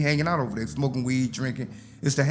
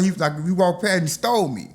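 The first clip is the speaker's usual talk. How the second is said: usual speaking voice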